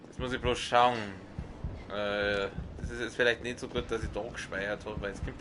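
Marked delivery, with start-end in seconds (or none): none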